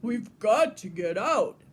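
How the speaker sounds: loud urgent shout. A narrator's reading. Trapped director shouting to get out, loud. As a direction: yell it to break out. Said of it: "Mister Trebleclef shouts".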